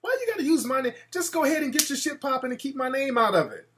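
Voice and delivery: high, soft, sugary mock question; a high, sweet retort to a gossip; mock innocent. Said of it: "I'm like".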